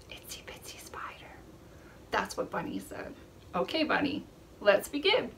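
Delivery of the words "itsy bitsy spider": soft unvoiced puppet whisper. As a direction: whisper like the shy bunny. Voicing the bunny's whisper.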